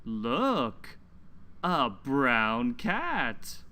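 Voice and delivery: slow, lilting picture-book reading; reading to children. Acted. Teacher reading a story aloud to children, slow and lilting. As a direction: read it slowly to little listeners, leaning on the numbers.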